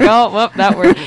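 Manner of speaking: loud, playful oops at her own slip; mock oops. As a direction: catch yourself mid-word and laugh it off. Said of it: "I'm like".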